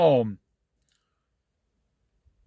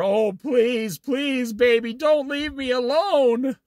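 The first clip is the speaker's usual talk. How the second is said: high, pleading, whiny plea; mock begging; 8 semitones higher than usual. Preacher mocking a husband begging his girlfriend, high whiny pleading. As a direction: ham up a desperate suitor begging. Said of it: "You were begging her".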